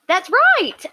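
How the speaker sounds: gasp, then That's right, high and loud; thrilled the cohost got it right; excited gasp. Real, not acted. Host thrilled the cohost spelled it right, gasping. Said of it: "gasp at the correct spelling".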